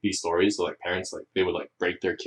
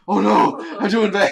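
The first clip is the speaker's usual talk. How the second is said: much higher, louder mock despair; mock panic; 14 semitones higher, 9 dB louder than usual. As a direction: wail it like a kid in panic. Kid voiced panicking.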